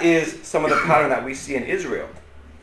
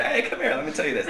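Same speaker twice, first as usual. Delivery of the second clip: hushed summons; 7 semitones higher, 7 dB quieter, faster than usual. Retold or imitated. Retold pulling aside by the ear.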